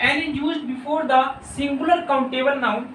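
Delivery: reads the rule out very slowly, word by word; slow dictation reading. Shown as a demonstration. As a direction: dictate the grammar rule slowly for students to copy. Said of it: "यही चीज़ जानने वाले हैं".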